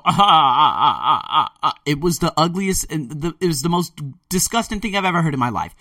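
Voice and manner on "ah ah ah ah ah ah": loud staccato mock laugh, ah ah ah; imitated ugly laugh. Imitating his friend Rocky's ugly laugh. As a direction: copy a friend's ugly sarcastic cackle. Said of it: "He would go".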